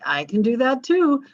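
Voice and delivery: light imitation of a boastful president; mock Trump boast. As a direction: brag like a president topping the last one. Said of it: "the Trump guy that went".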